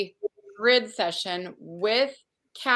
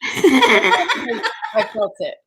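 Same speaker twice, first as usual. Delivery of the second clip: slow, drawn out through laughter; laughing; 7 dB louder, much slower than usual. Real, not acted. Host laughing with the guest about her modesty, laughing. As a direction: let the laugh carry the words.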